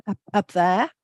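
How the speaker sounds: a quick shallow breath high in the chest; high chest breath demo. Shown as a demonstration. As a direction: show a tight, high breath before the words. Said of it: "we often breathe quite high in our chests".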